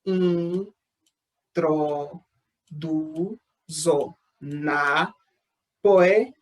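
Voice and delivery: syllable by syllable, long gaps between each; sílaba por sílaba. Shown as a demonstration. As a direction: sound out each syllable separately, drawn out. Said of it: "separei da seguinte maneira". (separando sílaba por sílaba, pausadamente)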